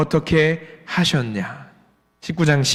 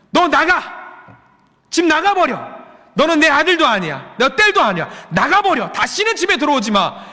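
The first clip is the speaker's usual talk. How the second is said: high-pitched acted parental outburst; upset parent scolding; 9 semitones higher than usual. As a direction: throw your child out in a fit. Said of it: "제일 속상하실 때 뭐라고 말하지요?". (화난 부모처럼 높은 목소리로 호통)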